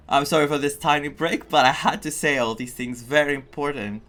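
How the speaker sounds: ordinary talk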